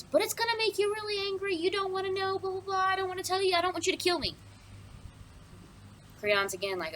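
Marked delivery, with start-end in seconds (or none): 0.0-4.3 very high, rapid mock whimpering plea; panicky guard. Guard fears the king will kill him, mock panic. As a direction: babble high and fast, hammed-up fear. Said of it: "because I'm afraid".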